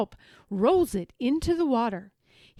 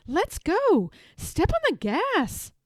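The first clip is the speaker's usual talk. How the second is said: higher, eager call to go; excited boy; 8 semitones higher, 4 dB louder, slower than usual. A narrator's reading. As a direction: urge them to hurry, bouncing. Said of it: "says Zephyr".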